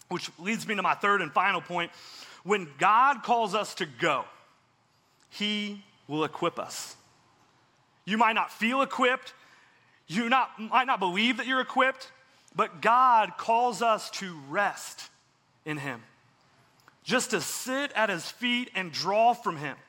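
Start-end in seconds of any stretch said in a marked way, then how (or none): 2.4-7.0 slow emphatic. Real, not acted. Preacher slows for key point. 12.5-16.0 slow emphatic. Real, not acted. Preacher slows for key point.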